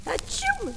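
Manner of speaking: a big, high sneeze from the princess. Acted. The frozen princess sneezes by the fire, a high sneeze. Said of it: "Elle éternue".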